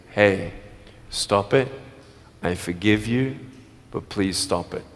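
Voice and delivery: low, measured request; calm, forgiving plea. Acted. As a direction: ask gently but seriously, pausing between phrases. Models forgiving plea to a teaser.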